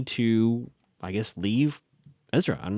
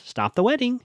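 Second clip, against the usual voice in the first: high-pitched mock doll voice; 11 semitones higher than usual. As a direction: pitch it right up like a talking doll. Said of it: "Hannah's doll says".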